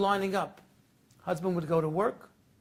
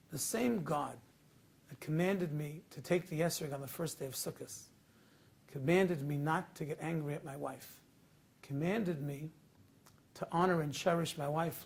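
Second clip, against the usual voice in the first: softer, lower, steady and gentle; calm reassurance; 4 semitones lower, 7 dB quieter than usual. Acted. Husband calmly reassures his sobbing wife, soft and steady. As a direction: soothe her, firm but tender.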